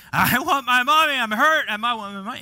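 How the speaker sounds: high, loud mock wail of a hurt kid; hammed-up child's yelling. Acting out a bloodied child wanting mommy, hammed-up yelling. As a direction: yell for mom like a little kid, easing off at the end. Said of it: "you start yelling".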